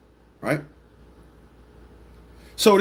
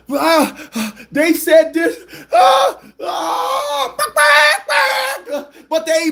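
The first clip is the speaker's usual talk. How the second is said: high crying babble, mocked; mocking clucking whine; 3 dB louder, much slower than usual. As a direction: wail like a clucking hen. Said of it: "just clucking".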